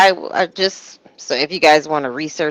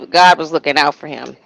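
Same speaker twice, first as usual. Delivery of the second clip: normal talking voice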